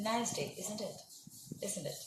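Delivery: "isn't it" falling away softly at the end; falling intonation. Shown as a demonstration. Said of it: "It is falling intonation".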